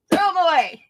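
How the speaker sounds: high, loud mock dismissal; old German repairman. Scissors man rejecting her scissors, hammed-up dismissal. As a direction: imitate him tossing out a verdict. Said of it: "that's how he talked".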